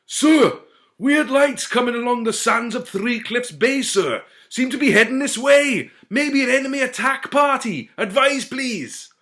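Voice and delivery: high, loud urgent report; anxious lookout. A narrator's reading. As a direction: report the danger breathlessly.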